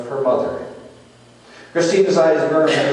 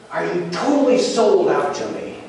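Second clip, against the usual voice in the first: raised, earnest question at moderate intensity; first searching question; 4 semitones higher than usual. Retold or imitated. Jesus questioning Peter's devotion, earnest and slightly raised. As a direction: ask it pointedly, pitch somewhat up. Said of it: "his voice rose each time he asked him".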